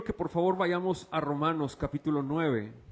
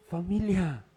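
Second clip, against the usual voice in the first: usual speaking voice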